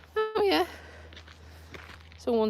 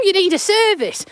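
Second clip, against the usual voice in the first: car voice; 9 semitones higher than usual. Retold or imitated. Voicing the car's warning message.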